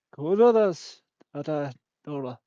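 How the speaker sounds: drawn-out pretend Irish; slow mock Irish. Shown as a demonstration. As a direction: sound out the fake Irish carefully. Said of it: "I'm speaking Irish".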